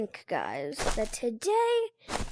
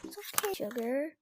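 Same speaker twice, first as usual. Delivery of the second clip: softer, hushed coaxing of the dog; hushed comforting; 4 dB quieter than usual. Real, not acted. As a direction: murmur her close to calm her. Cuddling crying dog softly.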